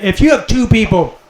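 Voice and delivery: ordinary talk, nothing marked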